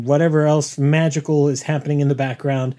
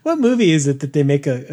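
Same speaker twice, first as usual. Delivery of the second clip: usual speaking voice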